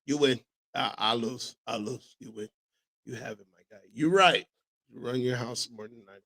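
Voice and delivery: low, soft concession; hushed, deflated admission of defeat; mock surrender. Real, not acted. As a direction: give in quietly, voice dropping away. Conceding the argument to Walt.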